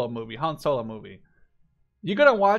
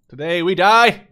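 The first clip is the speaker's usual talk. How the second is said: loud mock-heroic war cry, much louder; battle cry; 11 dB louder than usual. Gamer yelling a battle cry at his troops, loud. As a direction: bellow it like a general charging.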